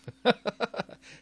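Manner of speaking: high mock villain laugh. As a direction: cackle like a pretend schemer. Villain laugh after evil line.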